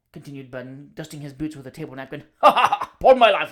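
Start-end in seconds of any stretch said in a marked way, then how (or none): 2.4-3.5 boisterous laugh. Acted. Boisterous guest laughs at table.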